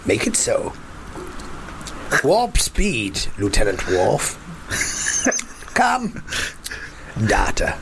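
mock starship captain. Captain summons the android officer to join him, mock commanding. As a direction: play-act a captain issuing commands.

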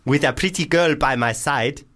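put-on full South African accent, overdone. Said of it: "is this going full African?".

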